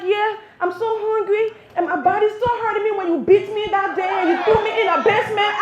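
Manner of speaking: put-on high, loud wailing kid act; hammed-up child complaining for the crowd to hear; shouting little boy. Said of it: "the little boy starts shouting".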